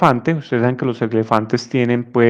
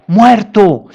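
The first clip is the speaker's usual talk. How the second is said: put-on blunt child answer; 7 semitones higher, 5 dB louder than usual. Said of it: "respondía".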